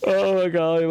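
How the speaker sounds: loud yawning exclaim. Real, not acted. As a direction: say it mid-yawn. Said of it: "get rid of these yawns".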